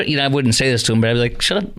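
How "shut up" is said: high-pitched mock heckle; acted indignant interjection; heckling boo. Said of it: "My, my boo would be saying".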